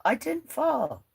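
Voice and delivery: high, slow, playfully offended; mock defensive. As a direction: protest it slowly in a high voice. Denies falling, answering chat.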